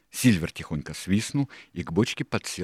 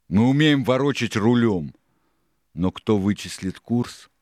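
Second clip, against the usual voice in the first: loud, raised ringleader voice; forceful pirate boss rebuttal; Silver, challenging; 6 semitones higher, 8 dB louder than usual. A narrator's reading. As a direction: challenge them, ending on a slower question. Silver argues with crew.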